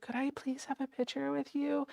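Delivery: high, soft, timid asking; meek little fan voice; timid request. Retold or imitated. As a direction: re-enact nervously asking a star, small high voice. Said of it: "I got the courage up".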